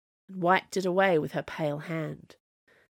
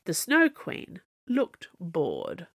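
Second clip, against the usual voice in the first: ordinary delivery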